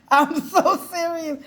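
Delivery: high, laughing through the words. Real, not acted. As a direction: crack up as you insist. Laughing repeat.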